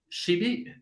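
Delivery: ordinary talk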